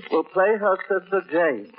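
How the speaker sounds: ordinary talk, nothing marked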